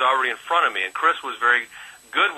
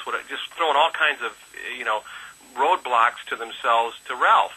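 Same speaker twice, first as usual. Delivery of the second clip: normal talking voice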